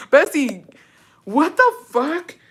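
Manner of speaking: high, drawn-out disbelief; shocked squeal at the insult; stunned outrage. Real, not acted.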